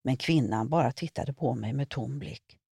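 ordinary delivery

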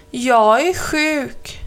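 slow whiny mock-sick voice; slow fake sick. A narrator's reading. Crow faking illness to Mamma Mu, slow whine. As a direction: drag it out, pretending to be ill.

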